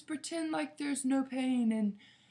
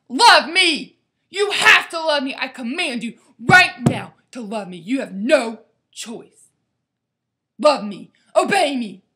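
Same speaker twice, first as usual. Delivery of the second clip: furious shouted commands, much louder; scary demanding God; 16 dB louder than usual. Retold or imitated. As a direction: yell it like a tyrant forcing love. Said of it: "In crazy Abby fashion".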